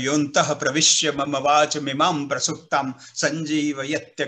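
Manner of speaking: low, measured chanting of a Bhagavata verse; Sanskrit shloka recital. Retold or imitated. An officer recalls his teacher reciting a verse, in low steady chant. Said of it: "ભાગવતનો શ્લોક સમજાવ્યો".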